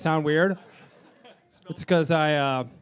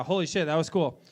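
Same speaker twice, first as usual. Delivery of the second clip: usual speaking voice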